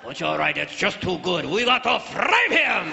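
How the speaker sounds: hammed-up Japanese accent; mock Japanese accent. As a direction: give in, then stretch the vow in a Japanese caricature. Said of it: "All the way to Japan".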